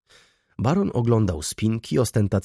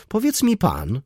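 raised voice; 11 semitones higher than usual. A narrator's reading. Lady confronting Klejn, with a raised voice. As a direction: pitch jumps much higher on "Powiedz mi pan". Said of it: "podniesionym głosem".

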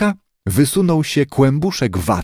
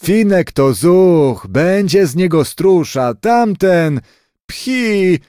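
higher, drawn-out boast ending in a scornful puff; boastful, then dismissive; 6 semitones higher, slower than usual. A narrator's reading. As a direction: praise one pup grandly, pooh-pooh the other. Grandfather boasts, scorns other pup.

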